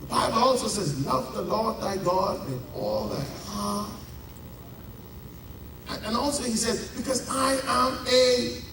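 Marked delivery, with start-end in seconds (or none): none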